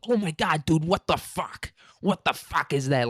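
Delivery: high-pitched raging gamer whine. Retold or imitated. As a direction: imitate a roommate's full-on gamer rage. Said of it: "full on gamer voice".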